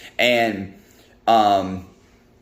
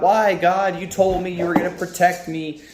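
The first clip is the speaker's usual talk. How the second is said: high, pleading mock prayer; panicked prayer; 7 semitones higher than usual. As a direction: plead upward as if protesting to God. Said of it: "he's praying to God".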